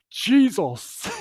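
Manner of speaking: put-on loud, high Jamaican accent exclamation; strong Jamaican accent. Toilet attendant sees the mess, loud Jamaican accent. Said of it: "He went in a proper strong Jamaican accent".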